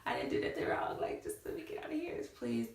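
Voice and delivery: hushed, half-voiced plea; retold praying, dropping into a whisper; whispered prayer. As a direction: whisper a desperate little prayer under your breath. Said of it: "I started praying and I was like".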